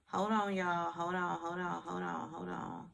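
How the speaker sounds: calling out while stepping back from the mic; off-mic hold on, fading; drifting off mic. Real, not acted.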